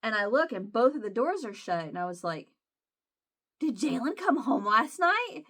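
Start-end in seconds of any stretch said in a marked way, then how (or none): 3.6-5.5 higher, slow, astonished retold question; surprised question. Asking husband about the shut door, surprised. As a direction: act out sudden surprise, pitch lifted.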